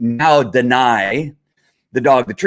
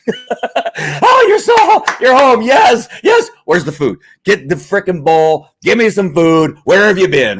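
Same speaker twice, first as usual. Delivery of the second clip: much higher, louder, shouting with joy; a dog beside itself that you're home; overjoyed dog; 6 semitones higher, 4 dB louder, slower than usual. Retold or imitated. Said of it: "Dogs are glad you're home".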